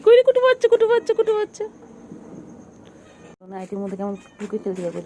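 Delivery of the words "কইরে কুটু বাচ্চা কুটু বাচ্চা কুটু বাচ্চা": loud high sing-song call for the kitten. Real, not acted. Searching for her cat, loud high calling.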